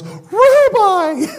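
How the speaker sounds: high joyful cry of recognition; Mary's cry. Acted. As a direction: cry out as if seeing him alive. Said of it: "She goes".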